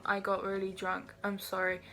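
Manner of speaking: ordinary delivery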